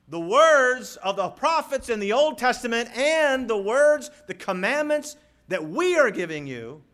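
high, loud pulpit voice; full-force preaching. Real, not acted. Preacher relays Peter's charge to remember, full-force preaching. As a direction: preach it out, hammering the key words.

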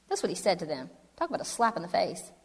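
usual speaking voice

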